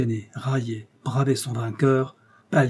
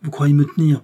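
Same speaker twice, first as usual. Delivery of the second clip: louder burst of sarcastic rage; raging outburst; 3 semitones higher, 6 dB louder than usual. A narrator's reading. Captive clown taunts the detective, in rage. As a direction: throw it out in anger. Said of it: "dans un élan de rage qu'il ne put maîtriser".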